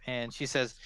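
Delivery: ordinary talk, nothing marked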